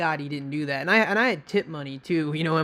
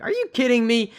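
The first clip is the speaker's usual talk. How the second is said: disbelief; 7 semitones higher, 6 dB louder than usual. Retold or imitated. Handcuffed in disbelief.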